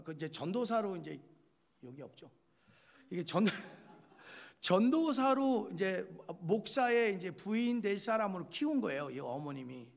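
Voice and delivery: ordinary talk, nothing marked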